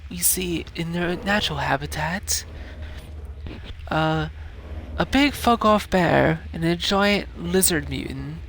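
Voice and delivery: hushed nature narrator; nature documentary. Pretend. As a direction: narrate like a wildlife film. Narrating animal fight.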